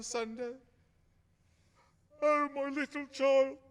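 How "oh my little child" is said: slow, higher, breaking with grief; a father grieving his son. Acted. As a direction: mourn the lost child aloud. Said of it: "Don't be grieved, Father".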